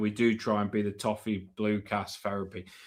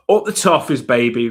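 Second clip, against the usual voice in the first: fan cheer; 9 dB louder than usual. Real, not acted. Sign-off cheer.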